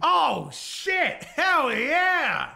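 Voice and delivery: high, loud gleeful cheer; loud delighted yell; excited whoop. Real, not acted. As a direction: whoop it up like you found a loophole. Cheering at terrorism loophole.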